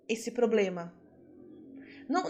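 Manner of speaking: ordinary delivery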